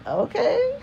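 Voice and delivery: high-pitched, hesitant "okay" as a question; awkward okay. Retold or imitated. Kids turning to their estranged dad, awkward. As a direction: imitate baffled kids with a squeaky rising lilt.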